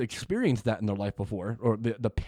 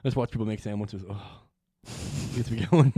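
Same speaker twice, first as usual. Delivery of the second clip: usual speaking voice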